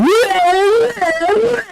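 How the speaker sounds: a high, shrieking burst of disbelieving laughter; incredulous shrieking laugh. Real, not acted. Venting at the developers about the camera, a shrieking laugh. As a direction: laugh high and loud in outrage.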